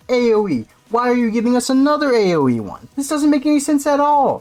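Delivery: high-pitched, indignant protest; exasperated rant. Real, not acted. Player arguing with designers over another AOE pick, exasperated rant. Ranting at the misplaced ability.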